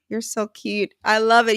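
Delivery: normal talking voice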